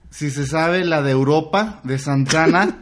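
voz de borracho lenta y arrastrada; imitando a un borracho. Retold or imitated. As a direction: arrastra las palabras como hermano bien pedo. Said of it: "le dice mi carnal ya pedote". (el hermano borracho le pide la canción al músico, lento y arrastrado)

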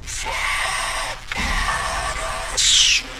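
distorted dying voice. Acted. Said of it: "o som distorcido".

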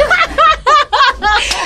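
high embarrassed giggle; high laugh. Real, not acted. Laughing at awkward memory.

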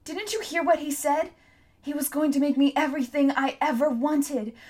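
high, loud anguished rant; raging grief. A narrator's reading. Hester rails at Tom over Shrike, high anguished rant. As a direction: pour out the bitter grief.

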